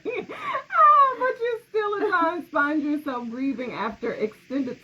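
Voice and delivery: high, loud voice breaking into tears; real tears breaking through the reading; reading a comment, suddenly crying; choking up. Real, not acted. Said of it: "I'm sorry".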